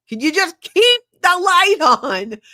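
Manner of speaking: high, loud retold outburst; exasperated out-loud plea. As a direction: cry it out to the empty room. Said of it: "I said out loud".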